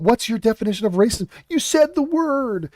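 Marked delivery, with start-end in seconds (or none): 1.5-2.7 mocking. Mocking the host's gotcha.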